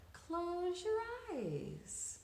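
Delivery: slow, soft and soothing; soothing therapist. Acted. Therapist calming Camellia, slow and soft. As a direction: lull her, eyes closing. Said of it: "she said".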